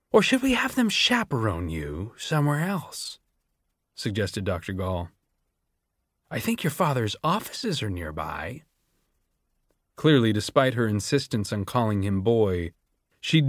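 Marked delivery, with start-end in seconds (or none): 0.1-8.6 sweetly menacing, dropping softer; veiled threat. A narrator's reading. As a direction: smile while you threaten. Gall threatens Sejanus sweetly.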